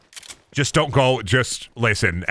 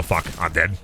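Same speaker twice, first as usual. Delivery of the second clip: normal talking voice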